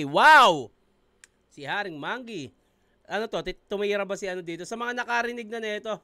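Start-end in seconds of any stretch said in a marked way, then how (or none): 0.0-0.7 very loud, amazed outburst; impressed exclamation. Real, not acted. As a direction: blurt it out, thoroughly impressed. Reacting to the featured artist. (malakas na bulalas ng paghanga)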